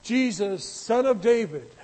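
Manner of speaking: loud retold cry, calling Jesus from the roadside. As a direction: call out loud to be heard over the crowd. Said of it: "would yell out".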